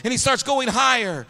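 high, loud, rising revival cry; full-force preaching. Real, not acted. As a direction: build to a shouted sermon climax.

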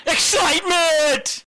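high drawn-out yell; excited shout. Real, not acted. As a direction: belt it out excitedly. Shouted enthusiastic interjection.

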